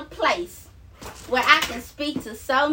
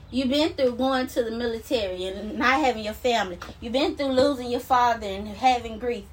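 usual speaking voice

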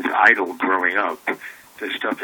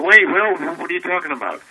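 startled call; 9 semitones higher, 4 dB louder than usual. Retold or imitated. Stopping the janitor from leaving.

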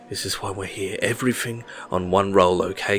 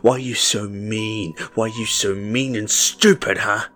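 furious rant; 3 semitones higher, 9 dB louder than usual. A narrator's reading. Red raging at boy.

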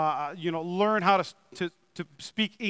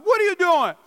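sharp, much higher and louder rebuke; harsh scolding; 10 semitones higher, 6 dB louder than usual. Acted. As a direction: snap it like an angry master. God imagined scolding harshly.

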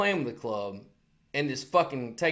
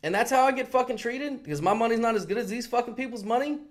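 higher, climbing as the grievance builds; retold indignant rant; 8 semitones higher than usual. Ranting at the manager about his money, angry. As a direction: let the outrage rise with each line.

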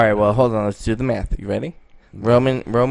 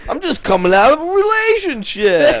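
high, loud Italian protest; mock Italian distress; 13 semitones higher, 5 dB louder than usual. Co-host as Italian guy fresh from breakup, mock pleading. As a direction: plead loudly in a comic Italian accent.

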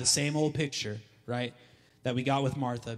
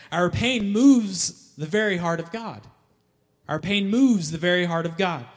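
louder and higher, MOVES hit; preaching build; 4 semitones higher, 6 dB louder than usual. Acted. Preacher stirring congregation, louder and higher. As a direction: preach it with force.

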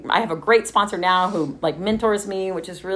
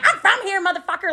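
mock tough retort; 12 semitones higher than usual. Defiant retort to mad-doggers.

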